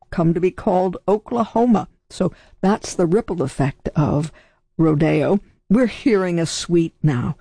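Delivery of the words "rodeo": "Rodeo" as ro-DAY-o; second-syllable stress. Shown as a demonstration. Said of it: "with the accent on the second syllable".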